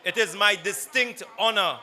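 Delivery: formal, building speaker introduction; announcer introduction. Real, not acted.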